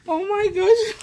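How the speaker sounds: high, loud, laughing oh my gosh; half laugh, half cry of joy; delighted laughing gasp. Real, not acted. Thrilled crafter opening her friend's gift, laughing high.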